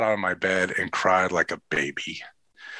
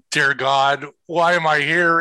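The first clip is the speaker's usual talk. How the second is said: put-on rising, louder plea of a scared kid; a touch of panic, pitched higher and louder; mock dread; 6 semitones higher, 4 dB louder than usual. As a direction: imitate a boy wondering why he's out there. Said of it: "this look on his face like".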